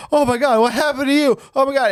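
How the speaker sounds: lightly voiced panicked sidekick; high worried Benji.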